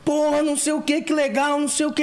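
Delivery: high, excited yelling; excited shouting. Retold or imitated. Yelling back at his brother over the award, excited. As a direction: yell it back, thrilled. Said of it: "eu comecei a gritar com ele".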